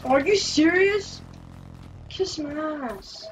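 usual speaking voice

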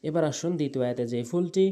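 usual speaking voice

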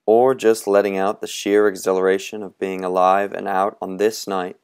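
usual speaking voice

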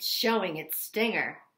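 plain reading voice

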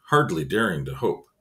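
ordinary talk, nothing marked

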